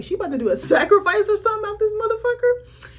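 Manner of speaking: high, laughing mock alarm; laughing disbelief. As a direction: squeal it out half laughing. Shocked retelling of movie reaction.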